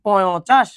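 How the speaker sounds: পয় চাষ as a mock misreading, "po-yo chash"; ভুল উচ্চারণ. Shown as a demonstration. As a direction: sound a false "yo" in পয় before চাষ. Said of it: "না". (পয় চাষ টেনে "পইঁয়ো-চাশ")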